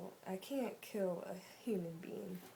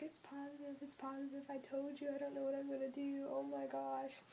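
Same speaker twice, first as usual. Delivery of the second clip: higher, tearful panicked imitation; retold crying panic; 7 semitones higher than usual. Phoning boyfriend after the positive test, imitated tearful panic. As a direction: replay the frantic, teary phone call, pitched up. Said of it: "I was crying".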